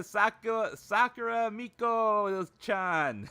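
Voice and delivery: high, drawn-out streamer voice; playful high-pitched name call; Miko. Acted. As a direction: stretch the name out in a high put-on voice. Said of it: "that was my Miko imitation".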